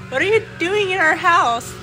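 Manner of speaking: ordinary talk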